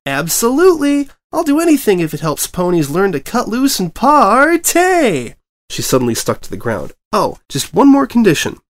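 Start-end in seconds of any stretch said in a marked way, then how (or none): 0.1-5.3 high, loud, gleeful cry; excited shout. A narrator's reading. Pinkie agrees to the story deal, bursting with excitement. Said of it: "hopped excitedly". 7.1-8.6 high, bouncy excited pony voice; bubbly Pinkie voice. A narrator's reading. Pinkie greets the returning reporter, high and bubbly.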